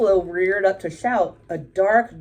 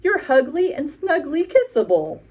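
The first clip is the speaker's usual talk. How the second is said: crow voice; 8 semitones higher, 4 dB louder than usual. A narrator's reading. Crow comforts the buffalo.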